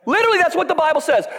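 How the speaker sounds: preacher hamming up Elijah's mockery of the prophets; laughing full-force preaching. Real, not acted. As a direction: shout and laugh through the taunts.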